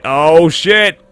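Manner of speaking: surprised shout into a laugh. Real, not acted. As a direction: caught off guard, laughing. Surprise at potion result.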